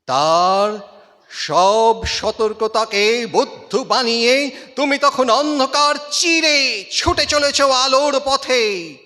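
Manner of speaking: loud, high recitation that builds; rising declamation. Acted. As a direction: swell the voice like a charge through the dark. Poem climax, escape in darkness.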